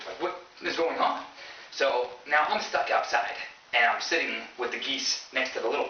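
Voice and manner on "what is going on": mock-scared, higher rising question; a touch of fright, pitch up. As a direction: act out bewildered alarm. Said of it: "Like, scared".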